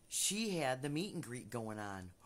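ordinary talk

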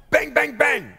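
high loud bang bang bang; knocking imitation. Storyteller mimicking knocks on door, imitated banging. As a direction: knock hard on the door with your voice.

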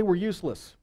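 usual speaking voice

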